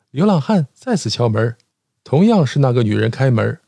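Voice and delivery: ordinary talk, nothing marked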